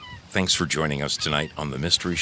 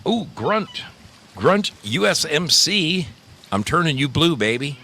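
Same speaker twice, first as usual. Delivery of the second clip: excited greeting; 9 semitones higher, 3 dB louder than usual. Real, not acted. Greeting a viewer, making him mod.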